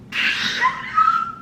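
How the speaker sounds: piercing, delighted squeal of laughter; high-pitched squeal. Real, not acted. Fan reading a friend's gift note, squealing.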